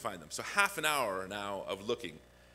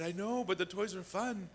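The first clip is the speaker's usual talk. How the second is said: high put-on little kid voice; playful child's protest, pitched up; whiny child; 10 semitones higher than usual. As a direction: whine the excuse like a six-year-old. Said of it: "I said".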